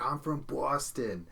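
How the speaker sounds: imitating a drawn-out Boston accent; slow, mock Boston accent. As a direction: mimic her Boston accent, stretching it slowly. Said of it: "with a Boston accent right now".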